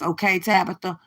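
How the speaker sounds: ordinary talk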